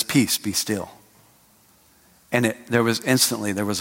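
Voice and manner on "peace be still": calm command. Retold or imitated. Jesus calming the storm.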